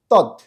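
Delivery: "তৎ" short, stopped on a final t with no vowel after; halant ending. Shown as a demonstration. Teacher showing the effect of the hasanta, stopped-consonant pronunciation. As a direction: cut "তৎ" off on the t. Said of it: "যদি দয়ের নিচে হসন্ত থাকে".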